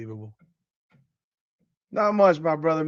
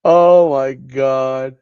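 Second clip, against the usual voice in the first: exasperated; 4 semitones lower, 8 dB louder, much slower than usual. Real, not acted. Exasperated after laughing at sports news.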